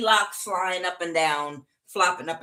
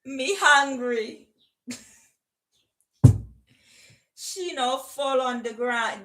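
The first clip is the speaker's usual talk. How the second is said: put-on Jamaican patois voice; Jamaican accent; 3 semitones higher, slower than usual. Reader playing a hungry, thirsty Jamaican, in Jamaican accent. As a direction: complain in a mock Jamaican accent. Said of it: "Don't make me do the accent".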